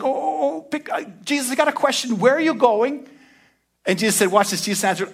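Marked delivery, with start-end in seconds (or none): 0.0-3.1 eager question. Retold or imitated. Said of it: "Thomas was like".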